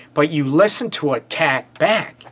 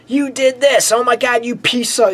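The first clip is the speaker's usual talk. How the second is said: mock yelling; 8 semitones higher than usual. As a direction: imitate someone screaming blame at a partner. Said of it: "You start yelling and screaming".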